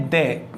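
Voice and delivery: "दे" said as the pre-consonant "the"; weak "दे", as before a consonant; weak form of "the". Shown as a demonstration. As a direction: say "दे" with a d plus a short neutral vowel. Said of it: "एक तो इसका उच्चारण है".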